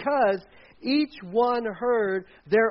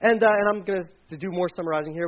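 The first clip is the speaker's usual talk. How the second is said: normal talking voice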